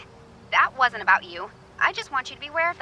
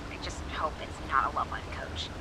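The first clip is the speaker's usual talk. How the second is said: snide whispered aside under the breath; 8 semitones lower, 6 dB quieter than usual. Acted. Radio host whispering a catty aside after hanging up. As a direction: whisper it as if off-mic, a little catty.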